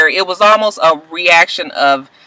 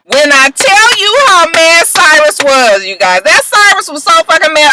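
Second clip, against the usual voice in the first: excited telling; 7 semitones higher, 3 dB louder than usual. Real, not acted. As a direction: really build up how mad he was. Telling how mad Cyrus was.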